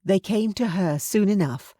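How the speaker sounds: ordinary delivery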